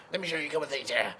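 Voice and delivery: quick, raspy old-bluesman impression. Retelling a respected player's advice to beginners, imitated raspy blues voice. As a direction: imitate a bluesman's rasp, fast and thrown out. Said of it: "He had the real raspy blues voice".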